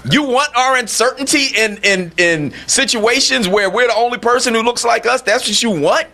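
radio host railing in disbelief at a fake identity; incredulous outrage. Real, not acted. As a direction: pitch it up in disbelief at the absurdity. Incredulous rant at the impostor.

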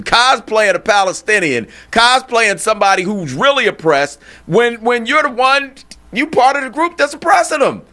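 incredulous outrage. Real, not acted. Outraged at the impostor's cosplay.

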